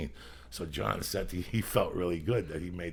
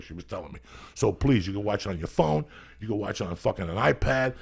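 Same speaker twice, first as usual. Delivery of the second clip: punchy, louder profane sponsor pitch; hard-sell ad read; 3 semitones higher, 7 dB louder than usual. Real, not acted. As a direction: push the sponsor hard, hammering the web addresses. Reads live sponsor pitch.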